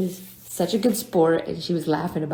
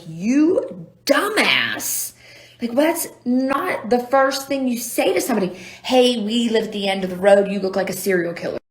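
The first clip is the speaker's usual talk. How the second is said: scolding herself higher and louder; self-scolding; 5 semitones higher, 7 dB louder than usual. Retold or imitated. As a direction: berate yourself in disbelief. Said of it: "I was like".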